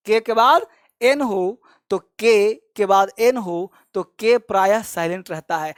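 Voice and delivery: ordinary talk, nothing marked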